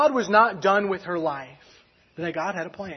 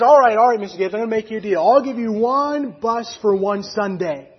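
raised, loud deal-making voice; higher and forceful, slowing on the terms; emphatic concession; 5 semitones higher, 8 dB louder than usual. Retold or imitated. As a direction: relent loudly, then stretch out the offer. Owner relents and proposes a deal.

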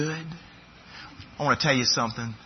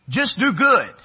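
higher, louder echo of the slogan; preacher echoing the do-good slogan, a bit louder; repeated mock slogan; 8 semitones higher, 8 dB louder than usual. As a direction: repeat it with a mocking lilt.

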